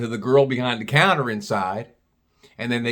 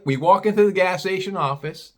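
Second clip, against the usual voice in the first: normal talking voice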